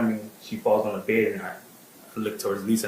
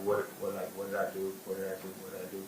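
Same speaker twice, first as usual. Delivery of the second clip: fading dazed murmur; 10 dB quieter, faster than usual. Retold or imitated. Friend repeating in shock.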